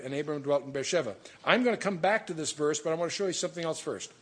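usual speaking voice

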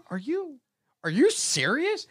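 high, slow, incredulous question; scolding dad. Retold or imitated. Dad confronts kid after high five, incredulous scolding. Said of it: "I yelled at my kid".

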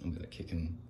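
ordinary talk

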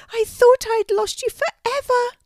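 very high, loud little-animal voice; high-pitched, emotional storybook youngster; exclaiming baby wallaby. A narrator's reading. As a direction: cry out as a reunited little wallaby. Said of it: "exclaimed the wallaby".

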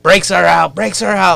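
hammed-up shout that the brakes are out; mock screaming. Friend in the front seat yelling as the brakes fail, mock scream.

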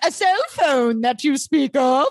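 high, slow olde-timey actor voice; Ren fair actor. Retold or imitated. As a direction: play the medieval character. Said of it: "annoyed by the actors who are like".

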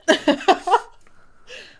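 loud, high burst of laughter; real laugh. Real, not acted. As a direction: laugh out loud at a funny phrase. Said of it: "sorry, I'm laughing".

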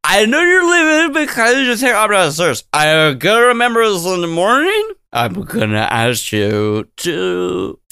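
put-on high, slurred drunken rambling; drunk tycoon. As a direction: ramble like a drunk rich man. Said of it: "He just sounds like Woodrow Wilson".

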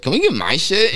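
high, loud mock-eager request; eager pleading. Teen asking the dad to switch, high eager plea. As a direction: play a kid pitching his better stash.

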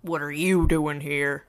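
low, slow menacing man's voice; deep voice. Acted. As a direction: drop low and draw it out. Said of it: "in a deep voice".

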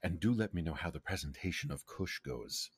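hushed aside, leaning in; theatrical whisper meant to be overheard; stage whisper. Acted. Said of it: "like, stage whisper".

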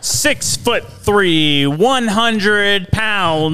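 ring announcer. Pretend. Co-host introduces the guest as a fighter, ring-announcer voice. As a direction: stretch the stats like a boxing announcer. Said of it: "Bruce Buffer better watch out".

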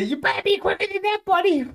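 high-pitched mocking mimicry; imitating a friend. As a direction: squeak out a friend's catchphrase. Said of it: "Or as Chad would say".

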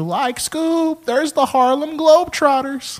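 high cracking cartoon voice, drawn out; excited cartoon slacker, stretching the words; Shaggy. Shown as a demonstration. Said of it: "Oh, Shaggy, obviously from Scooby-Doo".

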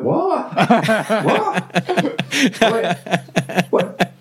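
high, laughing squeals of disbelief; can't believe what he just heard, laughing; retelling his stunned reaction with a high laugh; incredulous laughing disbelief. Retold or imitated. Retold shock at hearing news.

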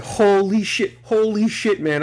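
high, amazed holy shit; excited exclaiming. Retold or imitated. Retelling the movie finale to listeners, high amazed exclaiming. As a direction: relive the thrill of the theater. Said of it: "I was just like".

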